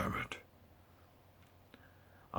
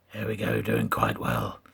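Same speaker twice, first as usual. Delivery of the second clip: low gravelly commentary; Batman voice; 12 semitones lower than usual. Pretend. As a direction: narrate the race as Batman. Keeps Batman voice during race.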